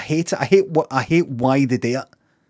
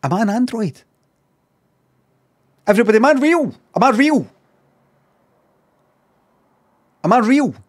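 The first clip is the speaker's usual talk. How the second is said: hammed-up, higher-pitched questioning; existential panic; 4 semitones higher, faster than usual. Streamer doubting he is human, hammed-up existential panic. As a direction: plead to know what you are.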